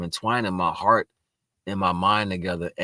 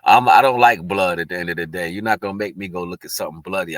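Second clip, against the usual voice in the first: ordinary delivery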